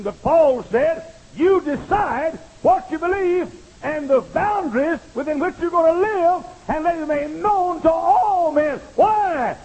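loud, driving pulpit exhortation; full-force preaching. Acted. Preacher exhorting new college students, loud and driving. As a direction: hammer each line home to the students.

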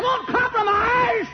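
high, slow, shouted; shouted climax. Real, not acted. Preacher to his congregation, high slow shout. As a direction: hammer the words home.

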